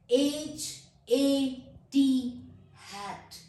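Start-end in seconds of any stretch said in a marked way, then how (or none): 0.1-2.3 very slow, louder letter-by-letter spelling. Shown as a demonstration. Teacher demonstrating how they spell, slow spelling. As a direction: spell it out firmly, each letter on its own. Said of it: "letter spell करती हूँ".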